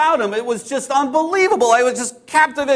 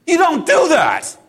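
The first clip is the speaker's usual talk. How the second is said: indignant outburst; 6 semitones higher, 4 dB louder than usual. Acted. Fisherman outraged at stranger.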